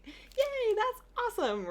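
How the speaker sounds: put-on excited little girl. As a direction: imitate a delighted kid squealing. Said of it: "She was like".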